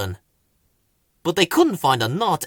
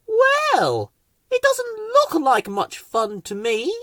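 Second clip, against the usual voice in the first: much higher sniffy voice; haughty Rose; 14 semitones higher, slower than usual. Acted. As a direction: dismiss it grandly. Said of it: "said Rose".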